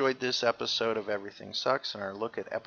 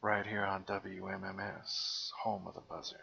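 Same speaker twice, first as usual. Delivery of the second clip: seventies FM DJ; 6 semitones lower, 10 dB quieter than usual. Retold or imitated. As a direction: ease into it like a late-night FM host. Continues FM DJ bit.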